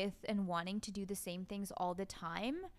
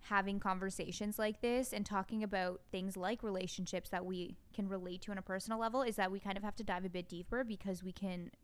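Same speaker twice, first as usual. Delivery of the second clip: usual speaking voice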